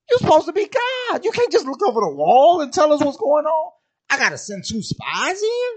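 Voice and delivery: high, loud, exasperated Joshua; incredulous Joshua. Retold or imitated. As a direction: squeal it out in disbelief. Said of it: "I'd be like".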